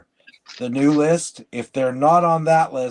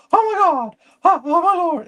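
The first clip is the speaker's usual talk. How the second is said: very high, loud mock wail; freaked-out boss impression; 5 dB louder than usual. Brother's boss used to freak out, high mock panic. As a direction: imitate a boss panicking in falsetto. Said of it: "my brother's impression of his boss".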